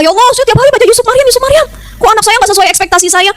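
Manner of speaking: high-pitched indignant complaint to God; marah-marah. Acted. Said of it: "Dia marah-marah, nggak terima sama Allah". (protes marah dengan nada tinggi)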